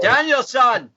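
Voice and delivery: high, loud mock Scottish "Daniel-san"; hammed-up Scottish mentor; Scottish Miyagi. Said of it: "Karate Kid was done in a Scottish accent".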